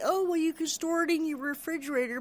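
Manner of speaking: lightly mocking, airy know-it-all tone; a light parody of smug advice; mocking people's advice. Mocking neighbours' smug advice, lightly sing-song. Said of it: "and then people say".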